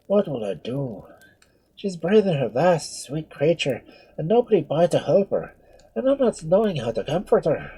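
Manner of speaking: alarmed, high Irish lament; frightened Irish priest. A narrator's reading. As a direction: fret aloud in helpless panic. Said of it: "he cried".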